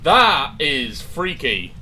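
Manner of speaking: slow, loud spooked voice. Real, not acted. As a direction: drag it out, creeped out. Scared by door, drawn out.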